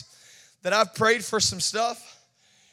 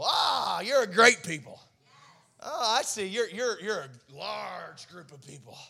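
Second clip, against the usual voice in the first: softer, dismissive mock awe; sarcastic; 2 semitones lower, 6 dB quieter than usual. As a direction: pretend to be impressed, then deflate. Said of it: "There's some sarcasm here".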